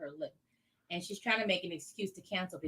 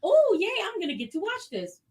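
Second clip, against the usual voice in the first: high, loud cheer of delight; gleeful excitement; 13 semitones higher, 12 dB louder than usual. Retold or imitated. Waiting friend getting to watch her show, gleeful. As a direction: cheer as if handed a treat.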